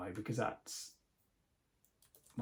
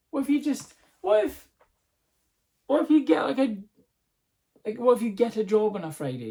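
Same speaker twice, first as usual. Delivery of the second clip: much higher and louder, baffled disbelief; incredulous outburst; 8 semitones higher, 8 dB louder than usual. Real, not acted. Vlogger baffled by the Good Friday dancing law, high and loud. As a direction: throw the question up in disbelief. Outraged hypothetical, voice jumps high.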